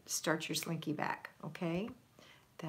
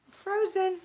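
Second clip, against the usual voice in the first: frozen cried out higher and louder; exasperated frozen; 12 semitones higher, 6 dB louder than usual. Real, not acted. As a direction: squeal I'm frozen in mock dismay. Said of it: "Yep, I'm frozen".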